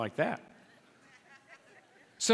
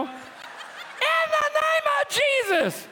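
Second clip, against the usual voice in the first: very high, very slow stretched cry; a laughing, hammed-up exorcism cry; drawn-out deliverance shout; much slower than usual. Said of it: "I start casting the devil".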